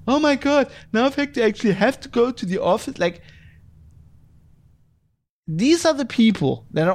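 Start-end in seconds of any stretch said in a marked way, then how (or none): 0.1-3.0 high, mocking whine of a complainer; mock whining. Podcaster mocking office-return complainers in a mock whine. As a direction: parody the spoiled worker groaning about the office.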